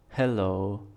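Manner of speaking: normal talking voice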